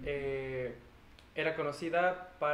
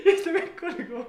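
high, laughing through the words; cracking up mid-sentence; 13 semitones higher, 8 dB louder than usual. Real, not acted. Laughing about choking.

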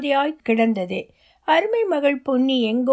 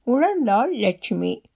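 ordinary delivery, nothing marked

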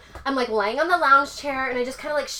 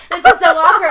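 loud, higher laughing groan; louder laughing cringe; laughing embarrassed; 5 semitones higher, 13 dB louder than usual. Retold or imitated. Mortified retelling.

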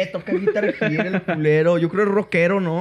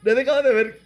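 ordinary delivery

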